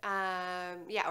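ordinary talk, nothing marked

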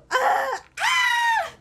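high shrieks of excitement; excited squeal. Real, not acted. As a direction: squeal like a fangirl. Gritos de emoción. (chillido emocionado)

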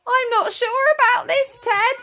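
mildly nervous, high-pitched character voice; a touch of worry, pitched well up; nervous Simon. A narrator's reading. Simon hesitating to Ted about a new friend, mildly nervous. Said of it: "Simon was a little bit nervous".

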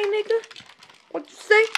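high, loud indignant question; angry challenge. Acted. Cashier confronting a rude customer, indignant. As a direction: challenge the insult.

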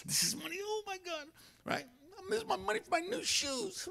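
high, soft whining voice; whiny money lover. Retold or imitated. Preacher mocks a believer loath to give, high breathy whine. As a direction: whimper over your shoe money. Mock congregant clinging to money.

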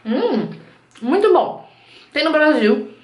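talking while chewing food; muffled through chewed cracker; mouth full, chewing. Real, not acted. Seen 3: Bit cracker at -4; lips pursed, chewing motion while speaking.

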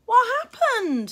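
a hint of a miserable boy, high and slow; ask, shaken by the sight; said miserably. A narrator's reading. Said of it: "he said miserably".

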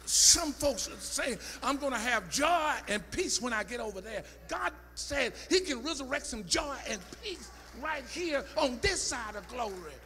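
loud, high, rising sermon cry; preaching at full force. Acted. Preacher shouting joy and peace to the congregation, full force. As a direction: preach it hard and let it ring.

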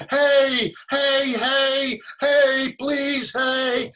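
high, loud yell for attention; imitated yelling. Homeless man calling after the actor, imitated high yelling. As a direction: call out after someone walking away. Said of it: "he started yelling".

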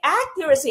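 accuracy hit on the opening AC; stress on first syllable. Shown as a demonstration. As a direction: model accuracy as AC-cu-ra-cy, front-stressed. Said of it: "stress is on the first syllable".

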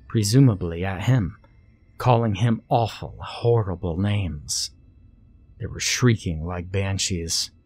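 ordinary delivery

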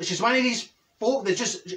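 usual speaking voice

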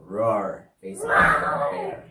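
loud roaring at the bear. Shown as a demonstration. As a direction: roar it out like a bear. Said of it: "except don't do the roar".

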